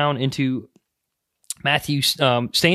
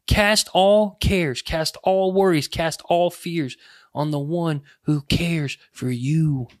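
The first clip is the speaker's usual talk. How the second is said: slow exhortation; slower than usual. Acted. Preacher urging the congregation to cast their cares on God, slow and fading. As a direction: lay it down word by word.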